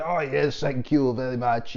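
lightly put-on French accent. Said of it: "that's my French accent".

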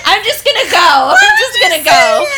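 high, loud laughing call; repeated loud laughing exit line; announcing departure. Retold or imitated. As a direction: keep shouting it out through the giggles. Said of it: "I'm announcing my departure".

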